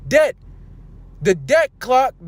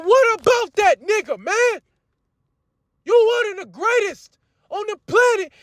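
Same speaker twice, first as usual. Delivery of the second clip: much higher mocking voice of a doubter; preacher mocking a brother who worries about Edomites, much higher; mock whiny objector; 11 semitones higher than usual.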